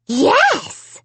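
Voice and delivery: high, loud gleeful dummy cry; dummy cries yes. A narrator's reading. Dummy answering Amy, a loud gleeful cry. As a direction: crow it triumphantly. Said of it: "he cried".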